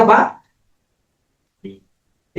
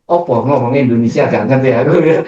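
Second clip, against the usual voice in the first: ordinary delivery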